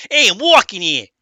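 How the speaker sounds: loud mock New Jersey accent. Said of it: "let me do your, your, your accent".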